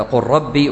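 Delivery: قل ربي merged as "qurrabbi"; idgham merging. Shown as a demonstration. Teacher recalling the example, قل ربي with lām merged into rā. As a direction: swallow the L of قل into a doubled R. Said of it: "اللام والراء يدغم".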